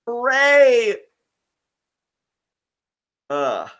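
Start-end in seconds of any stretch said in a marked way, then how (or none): 0.0-1.0 high, loud drawn-out hooray; one big hammed-up cheer; mock cheer. Cohort cheers to be handed the outro, mock cheer.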